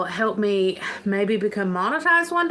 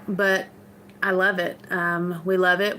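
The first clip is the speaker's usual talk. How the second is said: ordinary delivery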